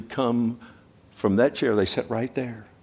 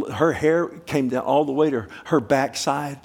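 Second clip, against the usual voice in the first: ordinary talk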